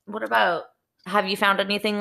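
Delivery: ordinary talk, nothing marked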